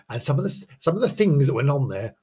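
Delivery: normal talking voice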